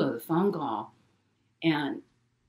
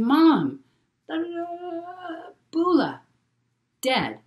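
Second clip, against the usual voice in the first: high, choked, broken sobbing words; imitating sobbing daughter; 13 semitones higher, 4 dB louder, slower than usual. Daughter sobbing news of a death to her mom, high and choked. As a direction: mimic a daughter too distraught to get words out. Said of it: "she's sobbing".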